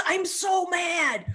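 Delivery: hammed-up slow pout; mock sulky mad. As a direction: play a sulky upset person. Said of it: "It's just".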